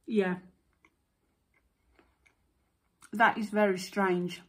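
talking with mouth full; chewing while talking. Real, not acted. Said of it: "I'm talking with my mouth full".

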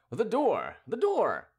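high-pitched cry, joining the shouting. A narrator's reading. Briquet joining the group's protest, crying out. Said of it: "began to cry also".

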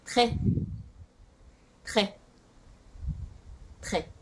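"トレ" as French "très", no vowel after T; fuse T and throaty R in "トレ"; "トレ" as one short syllable, repeated with pauses; T and R joined. Shown as a demonstration. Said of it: "をくっつけるとどうなるか".

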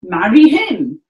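loud scornful Marry him; furious Lysander. Shown as a demonstration. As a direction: spit out the taunt. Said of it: "our phrase for Lysander".

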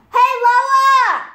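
loud, high holler to another room; calling out. Real, not acted.

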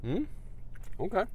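chewing while talking; muffled by food; mouth full. Real, not acted. Seen 0: Bites at -3, chews visibly until burger hides face.

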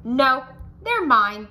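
grouchy ladybug screaming over the aphids; screamed. A narrator's reading. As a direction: scream it, climbing up high. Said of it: "screamed the lady grouchy bug".

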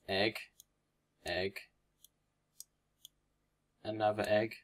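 usual speaking voice

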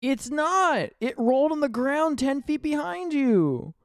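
high, excited alarm. Real, not acted. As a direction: call it out in alarm. Alarmed at the lion.

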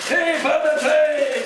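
high loud happy hey; cheering shout. Acted. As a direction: shout with delight. Monkey cheering.

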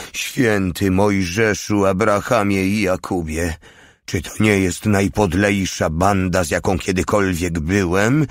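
ordinary delivery, nothing marked

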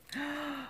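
soft, high intake of breath; gasp of delight. Real, not acted. Crafter seeing the tags, soft delighted gasp. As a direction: gasp in admiration.

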